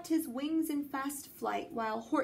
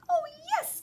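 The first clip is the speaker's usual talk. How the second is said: very high, louder piping squeak; 5 dB louder than usual. A narrator's reading. Who mayor answers Horton, high piping voice. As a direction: pipe it up like a tiny eager person. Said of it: "piped the voice".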